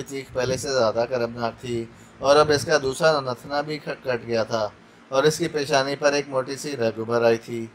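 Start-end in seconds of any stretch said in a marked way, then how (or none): none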